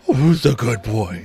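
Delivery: put-on slow baby talk for a dog. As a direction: coo at the puppy slowly. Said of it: "the dog voice where".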